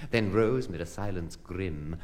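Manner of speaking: ordinary delivery, nothing marked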